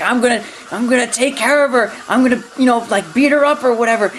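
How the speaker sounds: a hint of a menacing villain; lightly voiced villain. Villain Blue threatening her.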